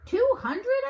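high, loud disbelieving question; can't believe the number; astonished. A narrator's reading. Boy astonished at the plan.

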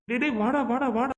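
high-pitched taunt daring him to come at you; taunting challenge. Acted. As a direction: goad him, come on then, come on. Taunting a rival to fight. (உயர்ந்த குரலில் சண்டைக்கு அழைக்கும் கிண்டல்)

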